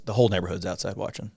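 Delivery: ordinary talk, nothing marked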